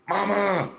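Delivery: high-pitched, loud mock yell; calling out for Mama. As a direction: holler for your mother in another room. Retold teen calling out to mother.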